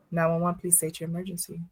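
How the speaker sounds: lightly voiced operator answering the line; calm dispatcher. Shown as a demonstration. Showing listeners how she answered calls, lightly voiced calm dispatcher. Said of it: "It looked like this, y'all".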